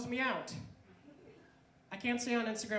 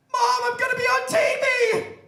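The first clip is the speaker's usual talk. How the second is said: very high, full-volume shout; excited yell to mom; 16 dB louder than usual. Acted. Recruited actor shouting the big news to mom, elated yell. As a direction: holler it joyfully through the house.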